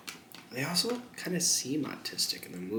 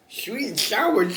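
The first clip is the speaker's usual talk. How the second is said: much higher, slower and louder take; high put-on voice; 12 semitones higher, 6 dB louder, slower than usual. Ordering chicken, saying it in a silly high voice.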